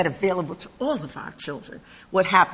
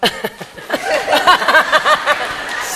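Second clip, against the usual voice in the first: big laugh; 8 semitones higher, 4 dB louder than usual. Real, not acted. Speaker laughs at the end.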